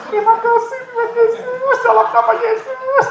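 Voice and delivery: high, excited pretend prayer with laughter; gleeful mock prayer. Wife hears husband cough next door, gleeful mock prayer. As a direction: egg God on, delighted.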